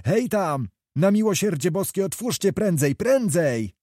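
high, urgent plea for help; read-aloud panicked shout, pitched high; terrified crying out. A narrator's reading. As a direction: cry out in alarm to be let in. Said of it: "głos jakiś począł krzyczeć za drzwiami przeraźliwie".

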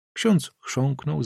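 plain reading voice

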